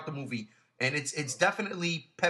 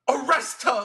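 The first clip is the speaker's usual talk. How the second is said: a louder, higher barked command; angry order; 7 semitones higher, 7 dB louder than usual. Retold or imitated. Father ordering his daughter's arrest, angrily. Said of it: "Like, he's pissed off".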